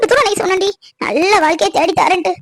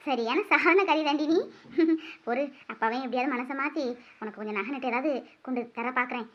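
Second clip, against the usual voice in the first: soft teasing; 7 semitones lower, 14 dB quieter than usual. Acted. Mother teases softly, laughing.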